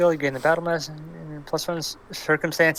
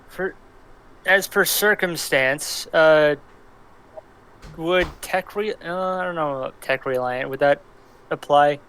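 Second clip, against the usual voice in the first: ordinary talk, nothing marked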